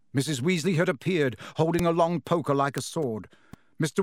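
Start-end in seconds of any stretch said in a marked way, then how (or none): none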